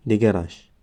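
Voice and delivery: ordinary talk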